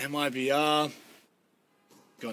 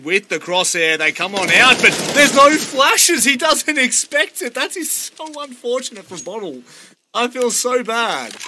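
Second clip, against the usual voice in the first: high, loud hype call; rising play-by-play excitement; esports caster; 8 semitones higher, 9 dB louder than usual. Real, not acted. Hyped live play-by-play call.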